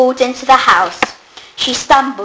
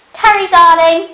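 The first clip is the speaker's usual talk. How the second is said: high, louder call across the room; 9 semitones higher, 7 dB louder than usual. A narrator's reading. Hepzibah calling Carrie over to see Albert, calling out. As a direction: call her over warmly. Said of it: "called Hepzibah".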